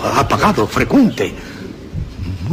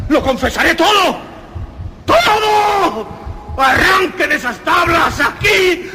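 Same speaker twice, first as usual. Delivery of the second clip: much higher, shrieking confession; frantic shout; slower than usual. Acted. The murderer screaming his confession at the officers, frantic. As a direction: break down and scream the truth. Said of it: "Era necesario gritar o morir".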